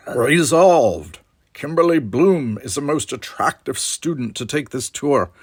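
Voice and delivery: loud, high, declaimed debate motion; debate team manner. A narrator's reading. Greeting a debate rival, in mock debate-team manner. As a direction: proclaim it like a formal debater. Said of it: "in his best debate team manner said".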